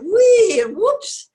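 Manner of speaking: high, loud squeal; gleeful cry. A narrator's reading. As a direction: shout with glee. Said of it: "they all cried".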